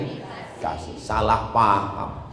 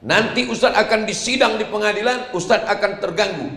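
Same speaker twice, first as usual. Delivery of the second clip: lightly voiced official, higher pitch; officer's warning; 8 semitones higher, faster than usual. Police officer warns the preacher of a trial, lightly acted warning. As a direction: imitate an official cautioning him, pitched up.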